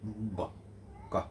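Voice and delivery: ব and ক as short separate stops; stop consonant sounds. Shown as a demonstration. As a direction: block the air, then release ব, ক. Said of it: "air pressure মানে কি".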